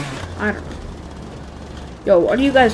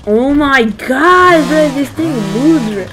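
amazed; 6 semitones higher, 5 dB louder, slower than usual. Real, not acted. Amazed by game.

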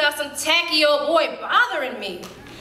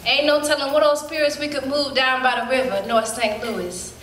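ordinary delivery

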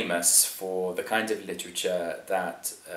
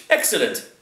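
loud lordly approval; emphatic aristocratic approval; approving lord; 3 semitones higher, 5 dB louder than usual. A narrator's reading. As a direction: pronounce it like a satisfied master.

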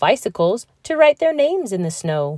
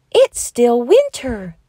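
slow, higher, gasping surprise; gasped; 5 semitones higher, 5 dB louder than usual. A narrator's reading. Bear cub waking to snow, dismayed gasp. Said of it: "gasped Little Bear".

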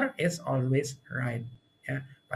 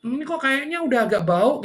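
high and louder, doubtful sniff; suspicious diner; 9 semitones higher, 6 dB louder than usual. Retold or imitated. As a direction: wrinkle your nose at the smell. Diner suspecting spoiled food.

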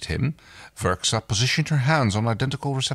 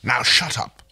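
short, dismissive snap; curt; 2 semitones higher, 4 dB louder than usual. Acted. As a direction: cut him off curtly. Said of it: "Rigel answered curtly".

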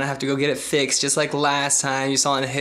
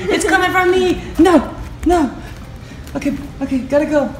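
very high voice for talking to a puppy; high pet talk; 12 semitones higher, 4 dB louder than usual. Real, not acted. Owner to puppy before leaving, high pet talk. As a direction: pitch it way up, as if to a puppy.

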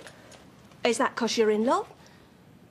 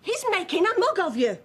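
high-pitched, heated telling-off; exasperated shouting; 7 semitones higher than usual. Acted. Mother trying to get through to daughter, exasperated shouting. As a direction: raise the voice to get through to her. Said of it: "stop shouting at me".

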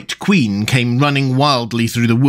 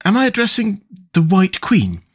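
tentative, higher-pitched polite question; rather timid; 9 semitones higher than usual. A narrator's reading. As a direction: ask with a touch of shyness. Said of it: "began rather timidly".

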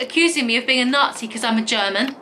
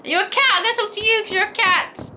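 talking to a cat; 9 semitones higher than usual. Real, not acted. Speaking to a cat character.

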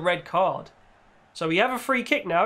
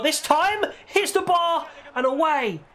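excited; 9 semitones higher than usual. Real, not acted. Near miss.

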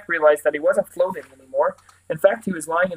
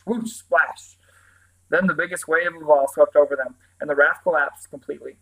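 ordinary delivery, nothing marked

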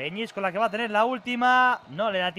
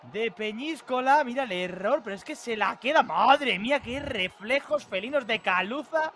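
normal talking voice

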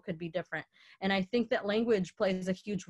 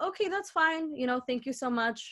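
politely backing off; 6 semitones higher, faster than usual. Retold or imitated. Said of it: "I was like".

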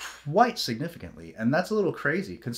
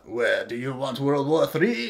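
mock Zelensky. Mocking Zelensky's threat in a put-on voice. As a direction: voice a leader's loaded question. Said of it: "you got Zelensky there like".

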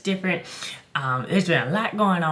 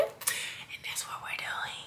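whispering; 14 dB quieter, slower than usual. Real, not acted. Hiding surprise from Kelsey.

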